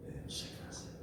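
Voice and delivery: hushed, breathless whisper; whispered wonder at the miracle. Acted. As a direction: whisper it like a secret marvel. Whispered repeat.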